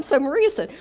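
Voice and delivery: ordinary talk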